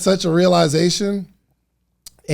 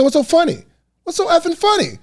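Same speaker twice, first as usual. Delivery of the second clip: high-pitched, indignant mock outburst; angry dad; 13 semitones higher than usual. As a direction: imitate a dad losing his temper. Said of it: "got really angry".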